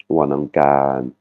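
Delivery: Wanangkan slowly, last syllable held long; long final vowel. Shown as a demonstration. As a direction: hold the "a" at the end of Wanangkan. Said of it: "On voit bien la voyelle longue". (Wanangkan, dernière voyelle bien allongée)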